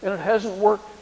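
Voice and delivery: a whiny, fearful complaint; whining. A narrator's reading. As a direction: complain like a frightened man. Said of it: "Elijah has the same response".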